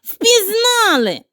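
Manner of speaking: high, slow panicked squeal; a piglet shrieking that he's been found out. Acted. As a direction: shriek it as he bolts. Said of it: "та як заверещить".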